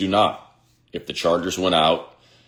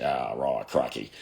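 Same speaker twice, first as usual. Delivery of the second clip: low, softer mock Aussie accent; bad Australian accent; 4 semitones lower, 5 dB quieter than usual. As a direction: imitate people badly faking an Australian accent. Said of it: "trying to do their Australian accent".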